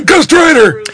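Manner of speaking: mock shout. Shouts character name as joke.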